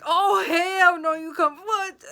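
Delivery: high, loud and slow, full of disbelief; outraged shout. Retold or imitated. Recalling her reaction to being ghosted, outraged shout. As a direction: blast it out like you can't believe it.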